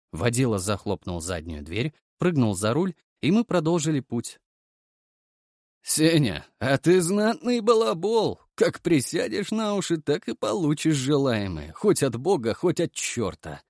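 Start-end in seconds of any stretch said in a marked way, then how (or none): 5.8-13.6 hoarse bass. A narrator's reading. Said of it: "хрипло пробасил мужик".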